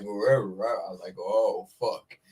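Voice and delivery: low, shaky mock fear; mock scared. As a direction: retell the fright in a low voice. Retelling fear climbing mountain.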